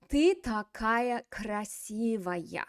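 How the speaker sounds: slow, clear reading. Shown as a demonstration. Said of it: "So we would translate this".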